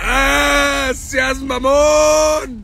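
loud, high-pitched mocking wail; exaggerated high, loud whine for help; mock pleading. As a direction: plead in hammed-up distress, stretching the last words. Mock plea for help, then jeering.

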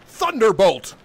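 loud, high theatrical incantation; wizard casting spells. Acted. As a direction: announce your attack like a grand sorcerer.

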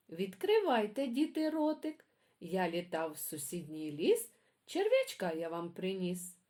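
put-on lively sing-song poem reading for kids; reading poems to children. A narrator's reading. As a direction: read it like a bedtime rhyme for little ones. Reads children's poems aloud.

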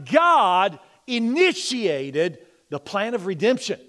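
loud, high-pitched pulpit preaching, driving each point; rising revival sermon voice; fervent preaching. Acted. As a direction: preach it full force to the congregation. Preaching at full force.

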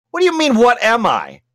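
mock affronted outburst; indignant surprise. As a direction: bristle at the question. Artist indignant at question.

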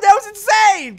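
high, loud thrilled exclaim; excited. Real, not acted. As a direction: marvel at the stunt. Excited after stunt.

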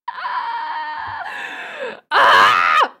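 drawn-out wail, then a loud yell; frustrated scream. Real, not acted. Streamer fed up with failing the accent, frustrated scream. Frustrated yelling after failed attempts.

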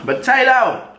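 high excited call of the word; excited shout. Real, not acted. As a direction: exclaim it with delight. Excited food exclamation.

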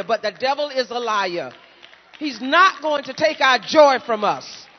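loud, rising pulpit preaching; fervent sermon climbing in volume; full-force preaching. Acted. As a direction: preach it hard and loud to the saints.

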